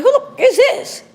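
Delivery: high, loud, slow outraged yell; alarmed shout. Retold or imitated. Her answering a stranger's call, alarmed high yell. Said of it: "I'm like".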